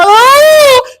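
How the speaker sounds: piercingly high, loud fake cry; hammed-up wailing; mock wail. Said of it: "what the fuck you crying for".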